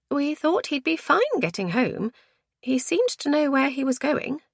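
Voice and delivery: high-pitched woman's character voice; high, worried explanation; concerned woman. A narrator's reading. Voices Lizzie.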